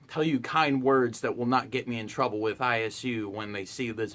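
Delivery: sarcastic soft kindness; mock kind. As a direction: pretend to be sweet and kind. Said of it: "A very kind manner".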